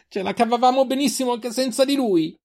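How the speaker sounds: very high, defiant cry; scream. A narrator's reading. Sister protesting they managed without him, screaming. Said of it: "urlò Selin".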